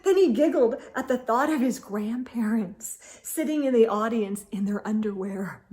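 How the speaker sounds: ordinary delivery, nothing marked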